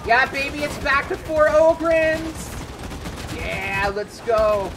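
loud, high, elated whoop; excited cheering. Real, not acted. Gamer hyped that the squad is four Ogryns, excited shout. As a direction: cheer it out at full volume. Celebrating a fourth Ogryn joining.

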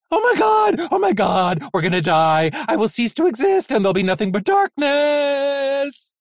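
high, loud panicked shrieks; panicked screaming. A narrator's reading. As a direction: scream it like a terrified little robot. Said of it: "A tiny vacuum robot screamed".

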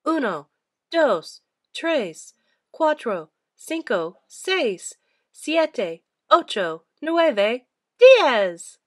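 put-on loud, lively counting for kids; counting aloud. A narrator's reading. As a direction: count each number like a teacher leading a class. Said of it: "Let's count them in Spanish".

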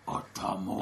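imitation of a very low-voiced robot; deep robot voice. Imitating the robot naming the comic character, deep voice. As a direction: drop right down into a mock robot voice. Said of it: "he has, like, a deep voice".

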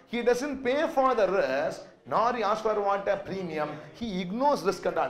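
ordinary talk

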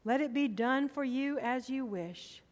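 ordinary talk, nothing marked

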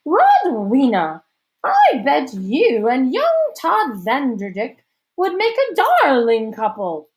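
loud, high, slow society lady; hammed-up matronly matchmaker; scheming matchmaker hostess. A narrator's reading. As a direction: gush it in drawn-out theatrical tones.

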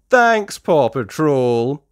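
slow relieved thanks; grateful owner. Acted. Mister Porter thanks the pups, slow and relieved. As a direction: thank them warmly. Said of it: "says Mister Porter".

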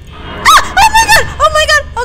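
high, loud shriek of shock; terrified scream. Real, not acted. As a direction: scream out as something jumps at you. Jump scare in the game.

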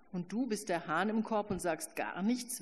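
plain reading voice

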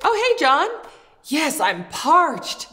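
normal talking voice

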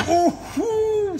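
high slow startled oh; surprised gasp. Real, not acted. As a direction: react to a sudden strike. Snake strikes suddenly.

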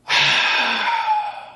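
long, exaggerated annoyed sigh; loud exhale. Annoyed schoolgirl before correcting the performer, loud exasperated exhale. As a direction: huff out a big breath. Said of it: "I could hear her exhale from, like, forty feet away. It was that loud".

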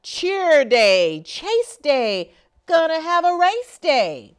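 bouncy sing-song read-aloud; rhythmic picture-book reading. A narrator's reading. Reading a rhyming book to children, sing-song. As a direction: read it with a lively rhyming beat.

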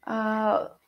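normal talking voice

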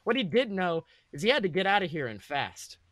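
usual speaking voice

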